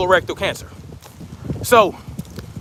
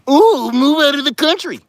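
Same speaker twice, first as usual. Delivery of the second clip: mocking whiner; 6 semitones higher, 3 dB louder than usual. Mocking people who want to leave.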